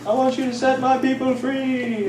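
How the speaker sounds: lightly hammed-up divine order; God voice. God tells Moses in a mock divine voice to free his people. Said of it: "God goes".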